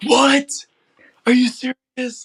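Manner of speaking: high, loud burst of astonishment; excited high-pitched shout of disbelief; Coach Cole, excited disbelief. Retold or imitated. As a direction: imitate someone jumping up and down with excitement. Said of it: "it felt like he was jumping up and down".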